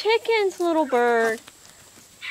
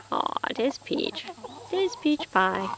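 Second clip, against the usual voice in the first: soft whisper; 6 semitones lower, 9 dB quieter than usual. Real, not acted. Cooing at a hen.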